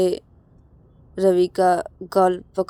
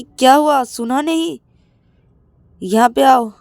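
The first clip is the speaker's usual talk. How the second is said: loud, high barked order; sharp, impatient summons; angry command; 6 semitones higher, 8 dB louder than usual. Acted. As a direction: snap at him to obey. Rahul orders Ravi to come.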